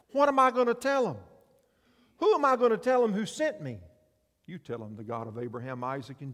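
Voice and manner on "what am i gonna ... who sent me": high, loud panicked questions; loud, high desperate plea; desperate Moses. Acted. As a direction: cry out in alarm. Moses voiced pleading.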